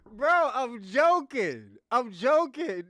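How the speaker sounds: loud insistence. Real, not acted. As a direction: drown out the caller: it was a joke. Insists loudly that it was a joke.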